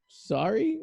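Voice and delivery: high, loud startled question; startled high sorry. Retold or imitated. Middle-aged guys startled by old lady, high surprised sorry. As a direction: jump up in pitch, caught off guard. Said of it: "they were like".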